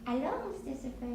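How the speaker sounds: put-on French accent. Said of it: "I was gonna be Paulette from France".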